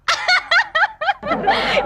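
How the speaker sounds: very high rippling laugh; high giggle. Acted. Clerk waiting for friend's reply on phone, giggling.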